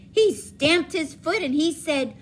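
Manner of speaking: usual speaking voice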